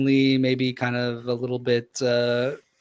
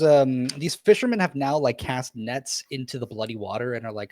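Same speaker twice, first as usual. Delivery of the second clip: ordinary talk, nothing marked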